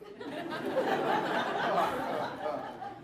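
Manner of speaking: long rising then falling laugh; high laugh. Real, not acted. Speaker laughs at thinking Nova made him famous. Laughing at his own joke.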